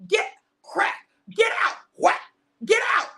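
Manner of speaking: rising angry shout at the traders; Jesus bellowing in the temple; furious Jesus. Acted. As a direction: drive them out with fury. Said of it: "he said".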